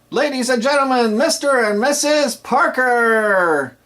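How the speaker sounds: high, loud, slow build-up to the names; wedding MC shouting out the newlyweds; enthusiastic MC introduction. Shown as a demonstration. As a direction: announce the couple so the crowd claps. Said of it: "it has enthusiasm there".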